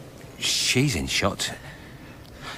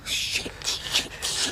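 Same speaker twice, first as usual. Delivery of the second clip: frightened whisper; 6 dB quieter, much slower than usual. Acted. Frightened hearing the tape.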